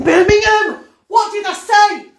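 high, loud excited cry; astonished outburst. Real, not acted. Realising what was said in the session, loudly astonished. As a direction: burst out with it, voice shooting up.

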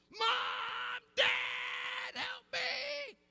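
put-on screamed high and loud, like a scared kid in the dark; child's terrified yell. Boy calling parents from bed, a terrified scream. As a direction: yell for your parents in terror. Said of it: "and I'd yell out".

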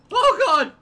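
very high, loud shriek of alarm; panicked scream. Real, not acted. Player about to die in game, panicked scream.

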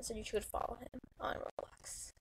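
soft whispered aside; whispering. Real, not acted. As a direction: breathe it out as a whisper.

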